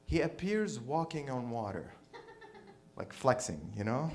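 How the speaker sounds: ordinary talk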